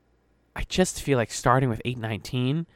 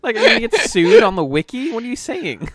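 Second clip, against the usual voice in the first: high, loud incredulous squeal; mock outrage; 11 semitones higher, 7 dB louder than usual. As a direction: act scandalized at the wiki.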